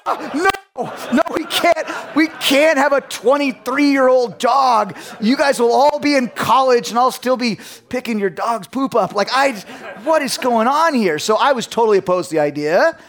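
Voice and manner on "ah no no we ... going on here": much higher, loud laughing shout; laughing protest. Retold or imitated. Dad refusing the kids' long-lived dog, laughing shout. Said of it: "I'm like".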